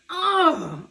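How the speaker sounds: short high cry as he falls; yelp of falling. Acted. Charlie Brown crashes down with a high yelp.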